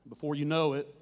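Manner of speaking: usual speaking voice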